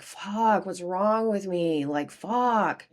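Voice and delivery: mildly distressed, drawn-out cursing; retold screaming and crying. Woman recalling asking what is wrong with her, mild anguish. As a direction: recall a frustrated outburst without really screaming. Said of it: "I remember just screaming, crying".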